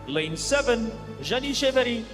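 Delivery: usual speaking voice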